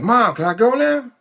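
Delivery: put-on high, whining child voice; high kid asking mom; impatient kid. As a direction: ask like a kid itching to leave. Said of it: "I said".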